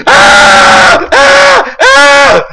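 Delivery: three high loud screams; startled scream. Real, not acted. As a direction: scream in sudden fright. Sudden fright in game.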